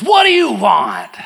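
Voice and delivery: slow, shouted movie-courtroom bark; Jack Nicholson impression. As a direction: play the angry colonel on the stand. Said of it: "And Jack looks back and says".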